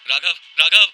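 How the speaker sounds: loud, high cry of the name; sleep-talking scream. A narrator's reading. Tanya crying out for Raghav in her sleep, screaming. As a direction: scream out from a nightmare. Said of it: "Tanya ने नींद में चिल्लाकर कहा".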